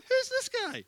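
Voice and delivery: very high mock disbelief; cocky young challenger. Preacher replaying David's question about Goliath, very high incredulous voice. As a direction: squeal the question in comic disbelief.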